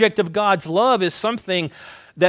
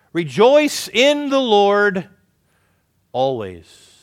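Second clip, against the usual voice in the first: slow, loud scripture reading, fading on always; reading scripture aloud; 3 semitones higher, 5 dB louder, slower than usual. Acted. Pastor reads the verse to the congregation, slow and loud. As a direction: proclaim the verse, then drop away. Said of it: "here in verse four".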